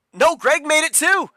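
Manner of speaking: high, loud excited call. Real, not acted. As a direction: still buzzing from the finish. Finish results called.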